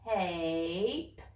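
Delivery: Tape drawn out slowly, sound by sound; slow stretched blending. Shown as a demonstration. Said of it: "Say it fast".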